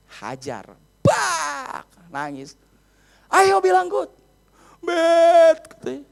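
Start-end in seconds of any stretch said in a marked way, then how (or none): 1.0-1.8 vocal sound effect of a hit; imitated whack. Imitates the blow of the stick. 3.3-4.1 high, loud demand; reenacted angry parental shout; imitated father's yell. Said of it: "Sampai saya teriak". 4.8-5.8 loud, high, stretched-out shout; imitated kid shouting back; defiant child's yell. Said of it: "Dia teriak lagi".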